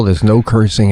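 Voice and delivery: normal talking voice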